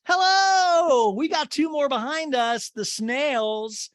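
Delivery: high, loud drawn-out hello easing down; mock mean-girl call. Podcaster imitating a mean-girl comment, mock catty call. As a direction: sing out a hammed-up catty greeting. Mocking retold cast member's jibe.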